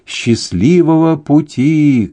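high, drawn-out farewell call; long-stretched shout of goodbye. A narrator's reading. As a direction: call it slowly after people far down the street. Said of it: "крикнул Фермини".

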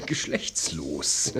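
low hushed whisper, slow. Acted. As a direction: whisper the word with a stifled laugh. Delicate word, suppressed laugh.

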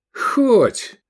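high, loud outraged echo; indignant exclamation. A narrator's reading. Friend scoffing at the ambition, indignant. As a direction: throw the word back in outrage. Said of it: "с негодованием воскликнул Искандер".

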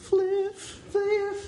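cartoon voice. Echoing the cartoon's Fliff.

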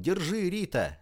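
high, urgent call to catch; shouted call. A narrator's reading. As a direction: call out as if throwing something. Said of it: "и с криком".

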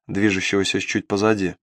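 usual speaking voice

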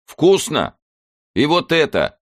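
put-on high loud shout with a Kazakh accent; shouting over machinery. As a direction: yell it over the train noise. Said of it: "прокричал старый милиционер".

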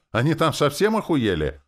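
high, loud indignant question; loud, high-pitched outrage; outraged. A narrator's reading. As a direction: explode at the absurd tax. Outraged at the daily tax.

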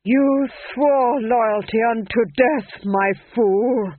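hiss. Acted. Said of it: "he hissed".

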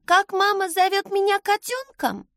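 put-on very high small-child voice; trusting little boy. A narrator's reading. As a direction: ask trustingly, as a young child. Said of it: "доверчиво уточнил Ро".

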